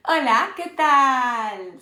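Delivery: Hola, ¿qué tal? slow and clear, like a teacher modelling it; slow model greeting. Shown as a demonstration. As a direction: stretch out Hola, ¿qué tal? for the learners to copy. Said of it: "What would you say?".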